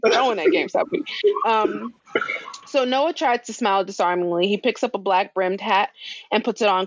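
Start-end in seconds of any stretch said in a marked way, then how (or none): 0.0-1.0 high, excited joking shout; hyped up. Real, not acted. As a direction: hype it up, laughing. Laughing hype riff.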